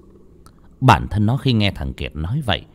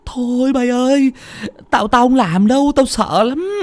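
very high, wailing stammer; terrified refusal; 3 dB louder than usual. Acted. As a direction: whine in fright, begging out of it. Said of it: "Thằng Kiệt tái mặt". (giọng the thé, run sợ, lắp bắp)

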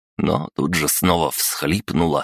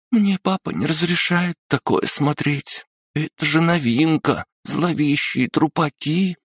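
put-on mumbling girl; 12 semitones higher than usual. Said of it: "пробормотала Рита".

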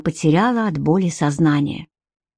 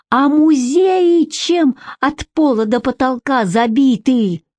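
higher, drawn-out furious question; angry hiss; 6 semitones higher, slower than usual. A narrator's reading. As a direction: spit it slowly into the listener's face. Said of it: "прошипел он ей прямо в лицо".

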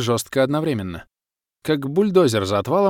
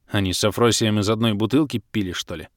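ordinary delivery

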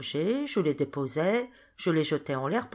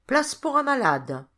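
raised, higher and louder call; 8 semitones higher, 5 dB louder than usual. A narrator's reading. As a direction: call out to clear the crowd. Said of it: "jeta ces mots".

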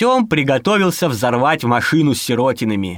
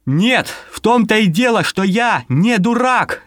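raised, higher, slowed shout; 7 semitones higher than usual. Acted. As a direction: yell back in protest. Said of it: "закричал сирота".

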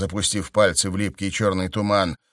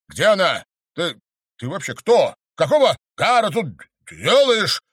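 loud, high, fast demands; frantic shouting; 10 semitones higher, 7 dB louder than usual. A narrator's reading. Count demands where the girl is, frantic shout. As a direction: shout at her in panic.

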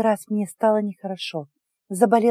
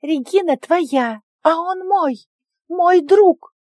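high, loud, slow indignant creature voice; angry declaration; 9 semitones higher, 5 dB louder, much slower than usual. A narrator's reading. Jealous pet angrily tells the man who belongs to whom, high and loud. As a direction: stamp your little foot. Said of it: "заявил сердито Понги".

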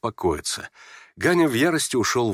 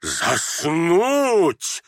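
indignant higher-pitched cry; shouting; 7 semitones higher than usual. Acted. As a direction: cry it out as an affronted general. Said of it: "крикнул генерал". (возмущённый выкрик)

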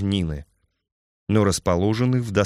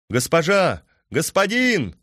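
calling out; 8 semitones higher than usual. Acted. Messenger calls out to riders.

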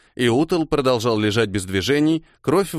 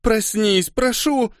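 high, panicked begging; panicked plea; 11 semitones higher, slower than usual. A narrator's reading. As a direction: beg in panic, voice climbing high. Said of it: "Ерта паниковала".

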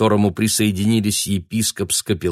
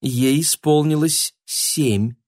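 sweet lady; 8 semitones higher, slower than usual. Acted. Mother answers sweetly.

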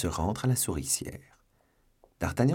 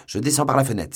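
higher, louder exclamation; exclaimed; 6 semitones higher, 8 dB louder, faster than usual. A narrator's reading. D'Artagnan shouting back to Planchet, bold and loud. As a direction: announce it as he leaps. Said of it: "s'écria D'Artagnan".

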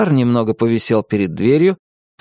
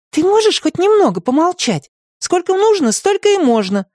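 put-on scolding girl. A narrator's reading. Katya scolds Tim.

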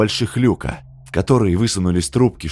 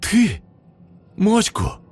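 high, incredulous questions; stunned accusation climbing high; shocked cry; 11 semitones higher, slower than usual. A narrator's reading. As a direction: rise sharply in outraged disbelief. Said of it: "Тут же закричала Жанна Сергеевна".

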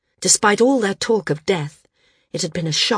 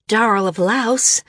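higher, hesitant reluctance; reluctant; 5 semitones higher, 4 dB louder than usual. A narrator's reading. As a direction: answer as if unwilling. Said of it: "San said reluctantly".

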